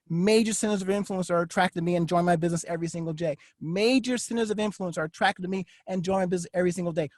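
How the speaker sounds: quick, low, hushed recital; murmured self-affirmation, said twice. Shown as a demonstration. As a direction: rattle off an affirmation to yourself. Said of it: "some of them are my primary affirmations".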